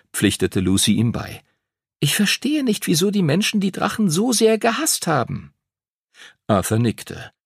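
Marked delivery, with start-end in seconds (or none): 2.0-5.5 put-on high-pitched girl's character voice; young girl struggling to understand; puzzled schoolgirl. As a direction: wonder aloud as a troubled girl, much higher. Lucy wonders about the dragons' past.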